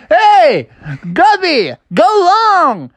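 calling out. Acted. Froggy calls to teammate.

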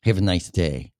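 lightly voiced angry New York customer; mock New York caller. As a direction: imitate an irate New Yorker on the phone, lightly. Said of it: "New York".

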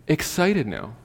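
ordinary talk, nothing marked

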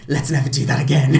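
laughing quote. Retold or imitated. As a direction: quote it loudly while cracking up. Film quote delivered while cracking up.

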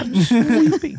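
put-on high, slow baby talk. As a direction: lisp it like a toddler. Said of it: "Perfect baby impression".

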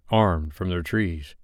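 ordinary delivery, nothing marked

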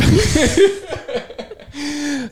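bright burst of laughter; high laugh. Real, not acted. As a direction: laugh at your own joke. Laughs at own plug.

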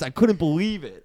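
ordinary delivery

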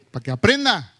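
normal talking voice

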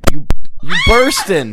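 high, loud mock accusation. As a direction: shout it out as you catch the culprit. Comic accusation of the culprit.

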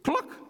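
quick high cluck; short high hen noise; chicken cluck. A narrator's reading. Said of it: "said a chicken".

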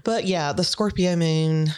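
ordinary talk

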